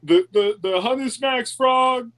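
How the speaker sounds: slow, put-on old politician; lightly voiced Biden. Friend rambles in a Biden voice.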